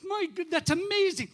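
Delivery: amazed priest, pitched well up; Father John. Acted. Priest welcoming a parishioner with big news, amazed high voice. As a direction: play the delighted Irish priest, high and exclaiming. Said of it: "And Father John said".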